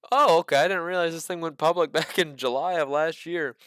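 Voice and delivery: laughing. Real, not acted. Laughs while realizing.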